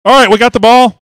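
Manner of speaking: high, loud, urgent recap; excited hype. Real, not acted. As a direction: pump up the moment before the kick. Relives the winning play excitedly.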